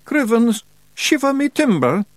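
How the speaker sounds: very high, loud cry; shouted exclamation. A narrator's reading. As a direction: burst out with a piercing yell. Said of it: "shouted a voice behind her".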